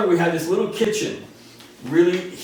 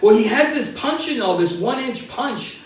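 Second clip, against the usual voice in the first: mom defending son; 8 semitones higher, 4 dB louder than usual. Retold or imitated. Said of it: "And my mom goes".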